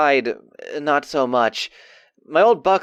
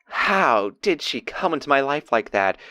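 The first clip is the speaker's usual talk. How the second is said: ordinary delivery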